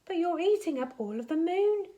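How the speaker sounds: put-on blurting boy voice. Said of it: "Charlie blurted out".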